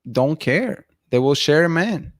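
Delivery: ordinary talk, nothing marked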